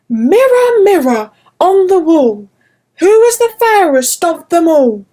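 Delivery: a proud queen calling on her mirror; vain queen. Acted. Queen asks magic mirror.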